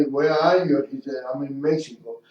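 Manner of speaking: normal talking voice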